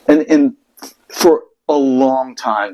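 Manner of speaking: usual speaking voice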